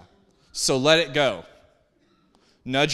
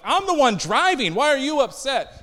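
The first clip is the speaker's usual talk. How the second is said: raised, louder mock exasperation; exasperated driver; 9 semitones higher, 4 dB louder than usual. Driver arguing with his upset passenger, mock exasperation. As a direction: throw it back at the passenger, pitched high.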